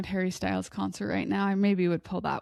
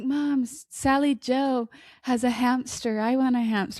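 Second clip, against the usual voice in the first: lightly voiced pleading child, higher; whiny kid; 5 semitones higher than usual. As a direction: beg like a little kid for a pet. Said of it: "I'm like".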